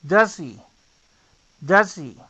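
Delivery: "does he" said as "dasi", h almost silent; reduced "does he". Shown as a demonstration. Teacher drilling the reduced does he. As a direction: drop the h: "dasi". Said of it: "el sonido de la j".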